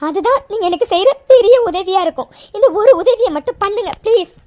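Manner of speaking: ordinary delivery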